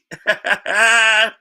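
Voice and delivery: loud mocking laugh; gloating laugh. Real, not acted. Laughing at Spurs fans after the jab, loud mocking laugh. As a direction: laugh right in their faces.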